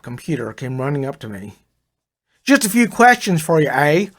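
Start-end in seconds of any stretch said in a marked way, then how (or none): 2.4-4.1 higher, louder Brooklyn voice with a Canadian eh; a Brooklyn wise guy with a touch of Canada; Brooklyn-Canadian accent. A narrator's reading. Fonzie-like clerk greets the new arrival in a Brooklyn-Canadian accent. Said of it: "his accent was somewhere south of Brooklyn with a touch of Canada".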